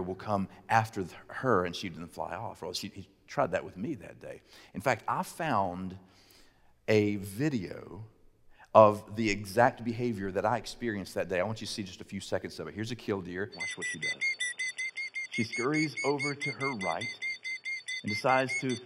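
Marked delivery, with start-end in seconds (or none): none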